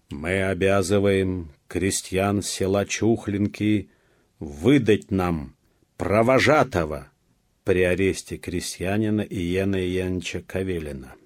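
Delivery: loud, measured official proclamation; loud and distinct. A narrator's reading. Police chief reads the protocol to villagers, loud and distinct. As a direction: read it out deliberately, word by separate word. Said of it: "громко и раздельно произнёс исправник".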